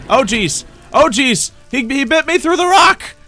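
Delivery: usual speaking voice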